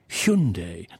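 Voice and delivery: Hyundai said as "SHUN-day"; mimicking the advert's Hyundai, SHUN-day; mock correct Hyundai. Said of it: "You can't say Hyundai".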